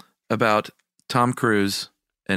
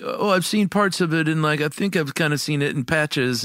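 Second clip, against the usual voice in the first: high-pitched mimicry of Noel; Noel impression; 8 semitones higher than usual. Host mocking co-host for half-seen movies, high-pitched Noel impression. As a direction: imitate Noel's hedging in a raised voice. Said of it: "That is a great Noel impression".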